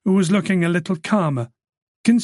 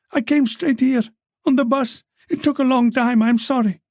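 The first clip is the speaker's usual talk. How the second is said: put-on frightened young woman; 7 semitones higher than usual. A narrator's reading. Nalini voiced throughout.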